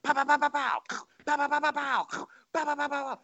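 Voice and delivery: high rapid pows in bursts; imitated pistol fire. [name] firing his pistol for the troops, imitated gunshots. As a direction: mimic emptying clips, burst after burst. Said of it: "he just starts dropping clips".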